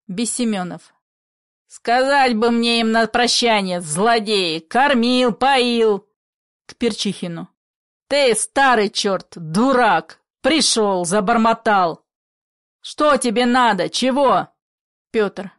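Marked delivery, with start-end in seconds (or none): 1.7-6.1 loud, bitter grievance; bitter anger. Acted. Father rails after those who left, bitter anger. 8.1-14.5 loud, irritated tirade; angry scolding. Acted. Father turns on Perchikhin, angry scolding. Father abuses the old visitor.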